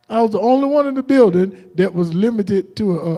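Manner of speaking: ordinary delivery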